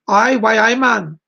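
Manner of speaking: American doing a Geordie greeting; Geordie accent. Shown as a demonstration. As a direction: show off some Geordie speak. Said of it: "I learned to speak Geordie speak".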